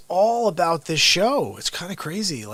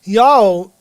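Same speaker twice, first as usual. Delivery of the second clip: normal talking voice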